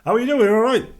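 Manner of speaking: cheery greeting. Retold or imitated. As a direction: beam a friendly hello. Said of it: "They smile".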